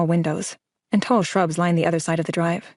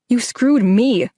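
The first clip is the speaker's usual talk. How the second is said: loud, higher, slow outburst; raised voice; 5 semitones higher, 5 dB louder, much slower than usual. A narrator's reading. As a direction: let it burst out, dragging each word. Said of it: "My voice elevates".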